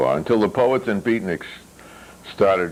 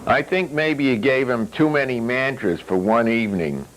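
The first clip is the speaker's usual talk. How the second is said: a slightly higher, different voice for Ethan; lightly voiced Ethan; 5 semitones higher than usual. A narrator's reading. Ethan needling Mogul about his reading, lightly voiced.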